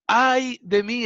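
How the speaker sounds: high, loud exclamation of dismay; strong exclamation. Acted. Isaiah seeing God's holiness, strong loud exclamation. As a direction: let the prophet's dread burst out. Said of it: "es una expresión tan fuerte".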